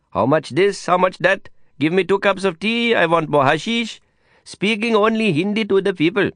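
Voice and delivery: put-on high, lilting Indian-accented friend praising his pupil; proud Indian teacher. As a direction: play the delighted teacher, voice pitched well up.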